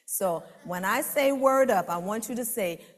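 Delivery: ordinary talk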